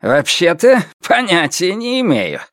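smug, lilting innocence; smirking. A narrator's reading. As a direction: feign ignorance with a smirk. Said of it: "ухмыльнулся Лю Тяньшан".

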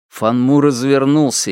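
usual speaking voice

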